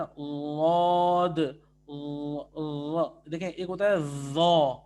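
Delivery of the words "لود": لود held very slowly, tongue side touching; light lateral dhaad. Shown as a demonstration. Tutor demonstrating the lateral sound again. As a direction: stretch لود gently. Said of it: "ہلکا سا".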